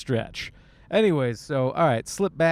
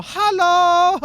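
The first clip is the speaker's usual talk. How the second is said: high, loud, booming jolly German greeting; 9 dB louder than usual. Retold or imitated. Barrel man greets everyone in a terrible jolly German impression. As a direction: belt out a cheery beer-hall hello. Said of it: "a guy pops out of the barrel".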